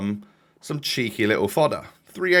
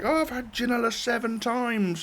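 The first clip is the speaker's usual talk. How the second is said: mocking fans; 11 semitones higher, 5 dB quieter than usual. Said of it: "everyone's like".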